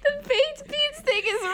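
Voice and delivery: very high, slow squeal; laughing insistence. Real, not acted. Insisting on baked beans, squealing with laughter. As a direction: squeak it out while cracking up.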